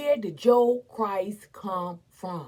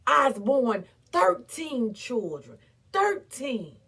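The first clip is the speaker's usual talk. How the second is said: normal talking voice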